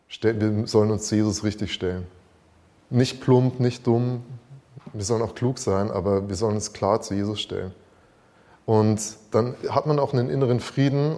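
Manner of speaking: loud, urgent preaching; fervent sermon voice. Acted. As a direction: press the point home to the church. Louder preaching appeal.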